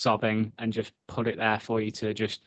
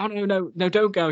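higher pleading imitation; lightly voiced, begging him to stay; mock pleading Danny; 8 semitones higher than usual. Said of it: "when she's like".

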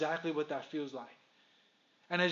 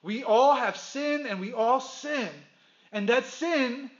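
higher, louder, driving sermon delivery; preaching at full force; 3 semitones higher, 6 dB louder than usual. Acted. Pastor drives home that everyone is spiritually dead, fervent preaching. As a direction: preach it out to the whole room.

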